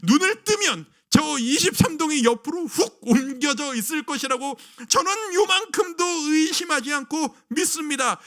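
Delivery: ordinary delivery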